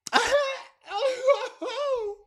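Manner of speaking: big high burst of laughter; loud laugh. Real, not acted. Laughing out loud to her live viewers at the memory. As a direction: cracks up loudly at her own story.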